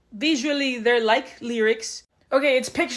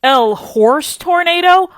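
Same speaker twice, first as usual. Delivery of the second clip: slow, higher-pitched baffled echo; incredulous; 5 semitones higher, 10 dB louder, much slower than usual. Retold or imitated. Daughter questions mom's made-up word, incredulous. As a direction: repeat it in stretched-out disbelief.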